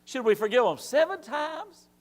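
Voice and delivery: Peter asking. Retold or imitated. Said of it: "Peter says".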